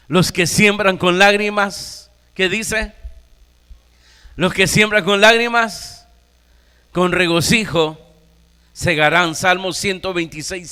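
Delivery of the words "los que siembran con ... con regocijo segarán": preacher calling out the verse to his brothers; preaching at full force. Acted. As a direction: proclaim the promise to the church. Preacher proclaims psalm loudly.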